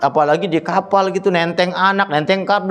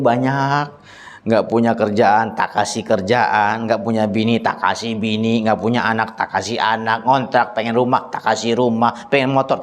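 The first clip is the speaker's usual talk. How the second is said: much lower, steady voice of God listing gifts; 10 semitones lower than usual. Acted. Preacher voicing God to congregation, low solemn reproach. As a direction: speak down as the giver reminding his servant.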